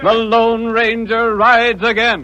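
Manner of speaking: announcer slogan. Acted. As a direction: stretch it out like a headline.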